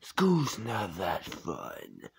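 low, very slow creepy robot killer voice; murderous animatronic. Retold or imitated. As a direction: drag it out like a menacing animatronic. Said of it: "like a fnaf animatronic after I murder someone".

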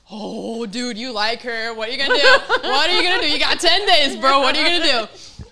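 loud, high panicky self-talk; mock panic with laughter creeping in; freaking out. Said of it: "internally, I was freaking out".

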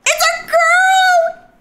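high, slow joyful shriek; shrieking jackpot winner. Real, not acted. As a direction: scream it like you won. Baby born.

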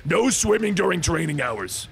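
lightly voiced stern sea creature; a hint of monster voice; Manphibian scolding. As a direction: lay down the pool rules in character. Manphibian scolds Wade in character.

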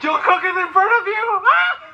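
high mock shout then scream; fake alarm shout. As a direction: cry out a fake warning. Pranking mom with fake alarm.

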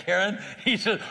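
normal talking voice

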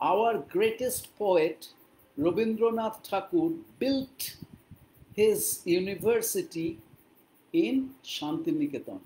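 slow, word-by-word English; slow model reading. Shown as a demonstration. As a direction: say it in separate chunks with long pauses. Teacher models English slowly for learners. (ধীরে ধীরে, থেমে থেমে ইংরেজি)

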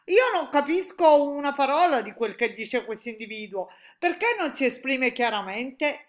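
loud, high-pitched complaint; indignant outburst. A narrator's reading. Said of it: "saltò su il capo dell'esercito". (sfogo indignato ad alta voce)

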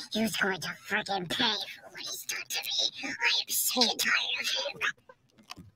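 menacing hushed whisper. Pretend. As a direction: whisper the threat darkly. Plotting murder in character.

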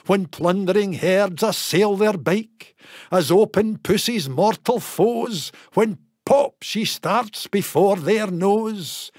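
ordinary delivery

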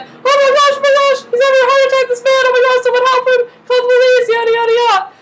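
high, loud, breathless-crowd panic; high-pitched, rushed cries for help; mock panicked bystanders. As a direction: squeal it in hammed-up alarm. Acting crowd panicking over heart attack.

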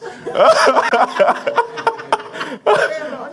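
long, high-pitched laughter. Real, not acted. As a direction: crack up completely. Laughs at own joke.

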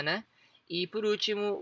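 usual speaking voice

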